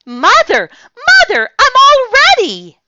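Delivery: put-on very high, loud, fast excited child; squealing eager little girl; eager calling. A narrator's reading. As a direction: call out bursting with eagerness. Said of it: "I said".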